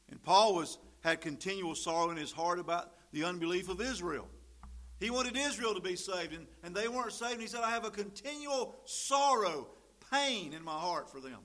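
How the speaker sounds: high, loud sermon delivery; impassioned preaching. Acted. Preaches at full force.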